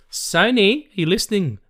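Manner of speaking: loud, high shout-out to Sony; calling out. Pretend. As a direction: holler it to get the studio's attention. Calling out to Sony mid-podcast.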